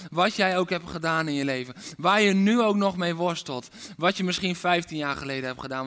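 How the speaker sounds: ordinary talk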